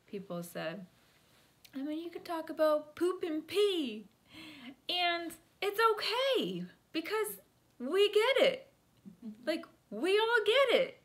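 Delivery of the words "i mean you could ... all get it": high, loud mock voice of encouragement; imitated group member. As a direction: imitate someone loudly reassuring the group in a high pitch. Acts out a group member's words.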